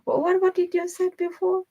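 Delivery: high, baffled repeated what; startled partner. Retold or imitated. Her partner asking what she just said, high and baffled. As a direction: play a boyfriend caught off guard. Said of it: "He said to me".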